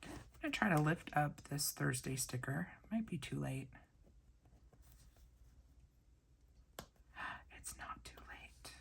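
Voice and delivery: hushed ASMR-style whisper; quiet crafting whisper; soft whisper. Real, not acted. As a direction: keep it breathy and close to the mic.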